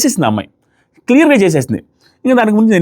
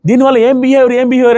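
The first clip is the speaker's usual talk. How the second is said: raised voice; 6 semitones higher than usual. Retold or imitated. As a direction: throw the question back with a raised voice. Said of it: "voice raise చేసి".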